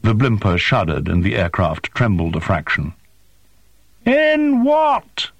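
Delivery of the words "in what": bellowed. Acted. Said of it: "Zilla bellowed".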